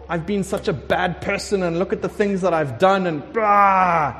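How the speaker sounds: rushing guilt that erupts into a loud blurt; agitated self-reproach. Acted. Guilty believer confessing to God, worked up. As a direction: heap blame on yourself, ending in a mock wail.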